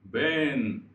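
ベーン with long loud vowel closing on N; final N ending. Shown as a demonstration. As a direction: draw out ベーン, finishing on a sounded N. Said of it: "というふうに終わる". (「ベーン」を伸ばしNで締める)

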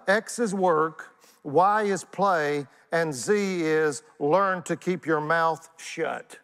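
very slow, spelled-out formula; slow teacherly dictation of a formula; Einstein's formula. Retold or imitated. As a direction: lay out each term slowly, deliberately. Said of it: "He said".

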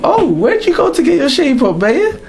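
put-on high, excited girlfriend voice; gushing girlfriend. Girlfriend greeting him home, high and gushing. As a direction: coo over his new haircut like a girlfriend. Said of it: "not me coming home".

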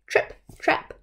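ordinary delivery, nothing marked

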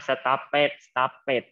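"stop it", with -ed made a separate beat; stopped misread in two syllables. Shown as a demonstration. Teacher warns pupils off the misreading, "stop it" in two syllables. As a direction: repeat the wrong two-beat "stop it", drawn out. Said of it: "มีสองพยางค์ ไม่เอานะ stop it น่ะ".

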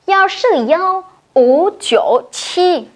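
幺四幺五九七 read slowly, digit by digit in Mandarin; Chinese digits. Shown as a demonstration. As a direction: model the string slowly for the class to repeat. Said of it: "đọc lại cho cô nhá".

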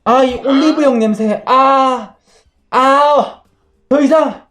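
high-pitched hammed-up swooning; mock overwhelmed passion. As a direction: fight mock urges, rising in pitch to frantic. Playing overcome by burning desire.

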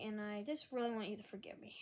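normal talking voice

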